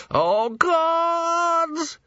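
very high, drawn-out wail; man about to fall, terrified. A narrator's reading. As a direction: wail in terror. Climax of panic.

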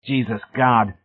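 stricken. A narrator's reading. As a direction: exclaim it as if mildly stricken.

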